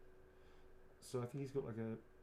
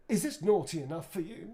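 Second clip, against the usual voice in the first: louder, higher cheeky in-character delivery; lightly voiced flirty Nick; Nick Lightbearer line; 6 semitones higher, 11 dB louder than usual. Actor performs Nick's pickup line for fans, cheeky. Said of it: "Nick would say".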